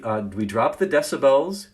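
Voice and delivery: ordinary talk, nothing marked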